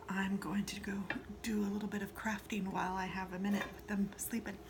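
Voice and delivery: soft hushed whisper so the dogs keep sleeping. Real, not acted. Baker whispering to viewers while her dogs sleep, hushed. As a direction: breathe the words, barely voiced. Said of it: "so I'm kinda whispering".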